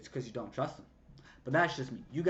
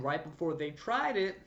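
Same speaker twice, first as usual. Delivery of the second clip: ordinary talk, nothing marked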